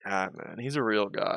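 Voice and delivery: usual speaking voice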